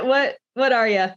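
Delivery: ordinary talk, nothing marked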